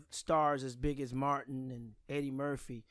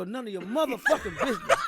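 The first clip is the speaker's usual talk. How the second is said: loud punchline; 8 semitones higher, 9 dB louder than usual. Retold or imitated. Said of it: "And Bernie go".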